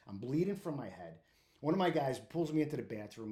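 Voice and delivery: usual speaking voice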